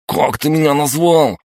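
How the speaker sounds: high, indignant wail; outraged yell. A narrator's reading. Sidorovets reacts to being called a coward, outraged yell. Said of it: "завопил тот".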